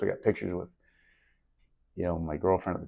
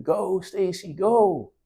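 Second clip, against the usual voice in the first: imitated yelling; 11 semitones higher, much slower than usual. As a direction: imitate a dad yelling encouragement from afar, pitched way up. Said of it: "was this voice yelling".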